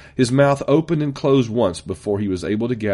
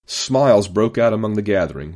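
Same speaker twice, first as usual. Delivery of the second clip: ordinary delivery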